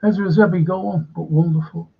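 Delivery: ordinary talk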